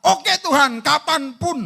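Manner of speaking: high, loud and eager; keen believer saying yes at once. Retold or imitated. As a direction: brightly promise God anything. Eager acceptance, acted quote.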